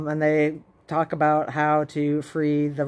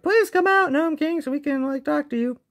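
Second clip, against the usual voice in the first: high singsong mock plea; high-pitched, put-on polite begging; mock pleading; 11 semitones higher than usual. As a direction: coax sweetly in a high retold voice. Retold plea to the Gnome King.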